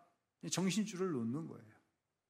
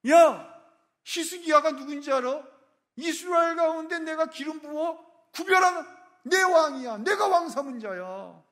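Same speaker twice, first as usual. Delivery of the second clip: angry shouting rebuke; 12 semitones higher, 12 dB louder than usual. Acted. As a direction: tear into him like an outraged father. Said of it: "계속해서 말씀하십니다".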